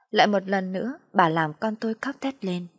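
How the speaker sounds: ordinary delivery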